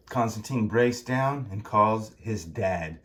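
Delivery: ordinary delivery, nothing marked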